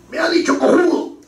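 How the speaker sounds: hammed-up offended congressman; mock outraged protest. Congressman complaining to the chamber, mock outrage. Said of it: "agarra y dice".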